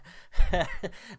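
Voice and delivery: loud real burst of laughter; sudden loud laugh. Real, not acted. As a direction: crack up at the name. Laughs at the name Rip Torn.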